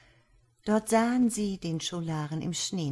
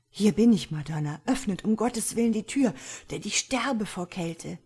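loud, higher pleading at a locked door; begging scholar; 4 semitones higher, 5 dB louder than usual. A narrator's reading. Freezing scholar pleading with the lady at the door. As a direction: beg to be let in from the cold.

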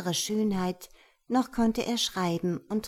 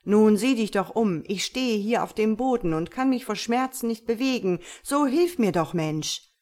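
pleading hidden voice; 2 semitones higher, 5 dB louder than usual. Acted. Hidden voice calls for help.

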